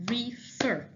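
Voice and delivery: "refer" in two separate beats, re, fer; split into syllables. Shown as a demonstration. Teacher showing students two syllables, "refer" said in two beats. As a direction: break "refer" apart at the syllable boundary. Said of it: "That's what two syllables are".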